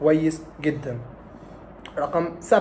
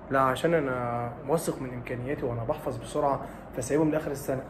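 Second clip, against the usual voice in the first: a quieter, casual student excuse; softer student voice; 6 semitones lower, 8 dB quieter than usual. Retold or imitated. Student brushing off the doctor's advice, softly. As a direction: shrug it off like a cocky pupil.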